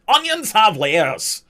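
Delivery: a deliberately poor Shrek; bad Shrek impression. Streamer joking about sounding like Shrek, hammed-up. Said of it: "Sound like I'm doing a bad impression of Shrek".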